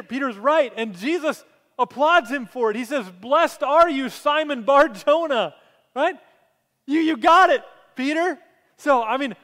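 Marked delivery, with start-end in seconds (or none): 3.1-8.4 excited praise. Acted. Jesus praising Peter, acted.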